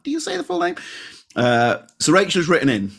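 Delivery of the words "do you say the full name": mock high squeak. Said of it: "and I'm like".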